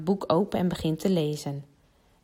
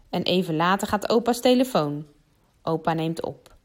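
ordinary delivery, nothing marked

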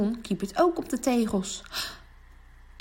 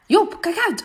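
shouted call across the pitch, much higher and louder; 11 semitones higher, 7 dB louder than usual. Acted. Boy on the pitch calling a warning to his teammate, loud. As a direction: yell to a teammate to watch out.

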